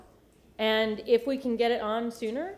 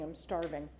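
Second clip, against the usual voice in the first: usual speaking voice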